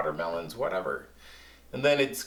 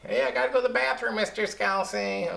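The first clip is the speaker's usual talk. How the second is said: put-on high, fast kid voice asking to go; student voice; 8 semitones higher, faster than usual. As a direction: mimic an eager kid, high and quick.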